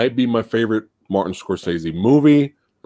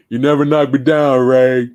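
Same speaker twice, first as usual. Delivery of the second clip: raised-voice taunting film quote; movie boxer's taunt; 5 semitones higher, 4 dB louder than usual. Acted. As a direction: call it out like a defiant boxer to his opponent. Quoting the boxer's famous movie line.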